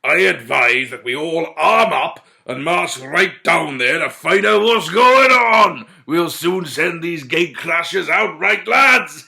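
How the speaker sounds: put-on loud drunken rallying call; beery drawl. A narrator's reading. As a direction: rouse your drunken men. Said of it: "in a beery drawl".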